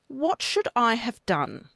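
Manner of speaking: ordinary talk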